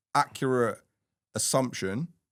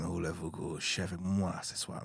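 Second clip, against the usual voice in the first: put-on French accent; 4 semitones lower, 8 dB quieter than usual.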